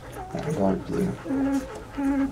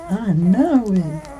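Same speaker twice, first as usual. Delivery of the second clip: slow, high cooing; 10 semitones higher, much slower than usual. Real, not acted. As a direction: coo it slowly and high. Cooing over a puppy.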